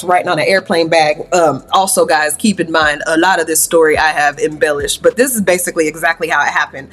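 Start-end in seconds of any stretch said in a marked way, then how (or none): none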